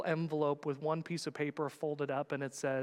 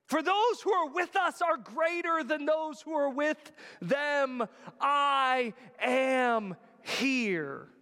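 high, loud shouted scripture; prophetic proclamation; 12 semitones higher, 6 dB louder, slower than usual. Acted. Elisha reassuring his servant, proclaimed loud and high. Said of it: "look at what he says".

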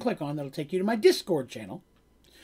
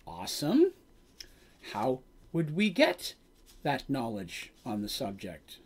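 slow, hesitant question, trailing off softer and lower; mock nervous; 5 semitones lower, 6 dB quieter than usual. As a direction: tread carefully, as if wary of the answer. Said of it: "They're very nervous".

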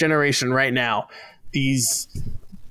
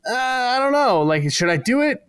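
hesitant Katara; 8 semitones higher than usual. Retold or imitated. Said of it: "Katara's like".

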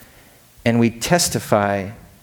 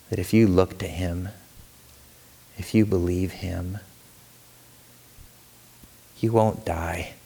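slow, soft, fading scripture; tender reading; 3 semitones lower, 4 dB quieter than usual. Acted. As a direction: ease down to a hush.

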